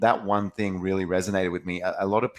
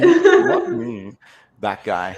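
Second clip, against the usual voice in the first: playful cat voice; 8 dB louder, much slower than usual. Pretend. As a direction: squeak it out, feigning innocence. Voicing cat denying blame.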